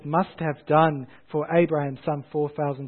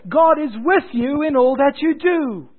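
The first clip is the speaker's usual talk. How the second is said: refrain cried out high and loud; ringing sermon refrain, pitched high; preaching at full force; 9 semitones higher, 5 dB louder than usual. Acted. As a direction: proclaim it from the pulpit.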